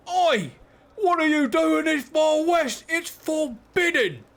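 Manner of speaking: high, loud, drawn-out Australian holler; Australian accent. Shown as a demonstration. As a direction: shout it slowly in a put-on Australian accent. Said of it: "was that the accent you wanted?".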